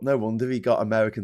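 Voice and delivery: ordinary talk, nothing marked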